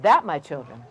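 low, measured Mama Mouse; wise mother mouse. Acted. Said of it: "And she said".

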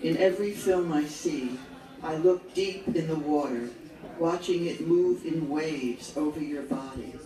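firm, measured verse recital in falling phrases; poetry read out to an audience; poem reading. Acted. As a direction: recite it line by line with pauses between. Reads homage poem aloud.

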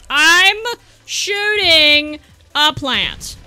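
very slow, stretched "I'm ... shooting a plant"; drawn-out exasperation. Real, not acted. Gamer shooting a plant, slow exasperated emphasis. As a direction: spell it out slowly, word by word. Same words exaggerated in exasperation.

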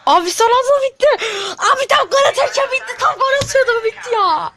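high laughing half-shout of dismay; laughing frustration. Real, not acted. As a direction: laugh out the disbelief, pitched high. Game ends just before his goal.

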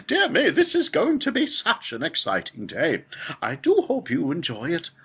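high, loud, fluty excitement; Willy Wonka. A narrator's reading. Factory owner welcoming the girl and her parents, excited and fluty. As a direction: gush in an excited, piping host voice. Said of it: "clearly as excited as everybody else".

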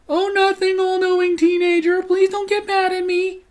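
fake-meek voice dripping sarcasm; sarcastic. Mocking the know-it-all teen, high fake-innocent squeak. Sarcastic mock reply to boyfriend.

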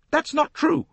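high, loud indignant protest; sharp denial. Acted. Lynette denying Poirot's charge, high and indignant.

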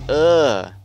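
loud, high frustrated groan; loud disgusted groan. Real, not acted. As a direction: groan at the cheater. Annoyed at glitch-hider.

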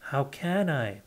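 usual speaking voice

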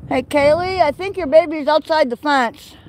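loud, high call across a yard. Real, not acted. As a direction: call out to alert a mother. Calling out to goat about baby.

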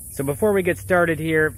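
talking through a face mask; muffled behind a mask. Real, not acted. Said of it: "you can kinda hear my muffled mask voice".

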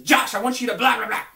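put-on a pushy kid yelling demands at Josh; demanding kid. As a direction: bawl it like a child wanting his way. Kid demanding something loudly.

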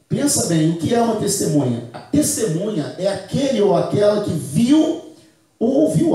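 preaching build. Acted. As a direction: push the point home to the church. (pregação crescente e mais alta)

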